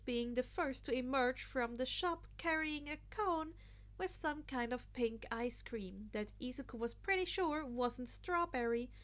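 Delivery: usual speaking voice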